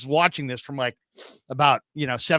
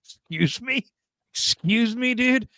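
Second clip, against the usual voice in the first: climbing higher with each mock excuse me; incredulous; 8 semitones higher, slower than usual. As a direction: sound offended and baffled. Offended retort to stranger.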